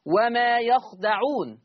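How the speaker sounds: slow recited "وما يخدعون", ya with fatha, kha with sukun, dal with fatha; Hafs reading of yakhdaʕun. Shown as a demonstration. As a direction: read "يخدعون" slowly as yakhdaʕun, no alif after kha. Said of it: "يخدعون بفتح الياء وسكون الخاء وفتح الدال". (قراءة حفص "يخدعون" بفتح الياء وسكون الخاء ببطء)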